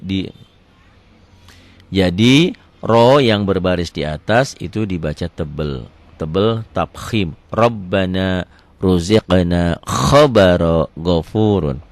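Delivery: normal talking voice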